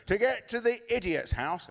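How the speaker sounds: put-on high, proud little girl's voice; child's punchline. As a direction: deliver it like a delighted kid. Daughter delivers her punchline.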